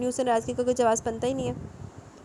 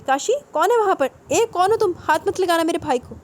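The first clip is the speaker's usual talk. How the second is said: high, loud frantic yelling; panicked brother shouting down the line; shouting into the phone; 7 semitones higher, 7 dB louder than usual. A narrator's reading. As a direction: scream at the unseen attacker to stop. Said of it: "زور سے چیختے ہوئے".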